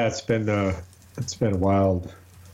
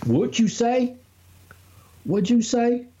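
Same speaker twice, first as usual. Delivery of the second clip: high-pitched hammed-up yokel; mock hillbilly; 11 semitones higher than usual. Asking his co-host about corn pone, high mock hillbilly.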